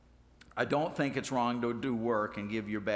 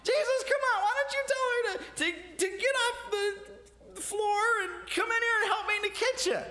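high, loud frazzled shriek at Jesus; shrill it out, a busy woman at her wits' end; frantic complaint; 3 dB louder than usual. Retold or imitated. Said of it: "She's got flour all over herself".

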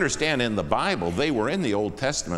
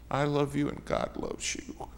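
tender, teary; 6 semitones higher, 5 dB quieter than usual. Retold or imitated. Teary son tells trucker.

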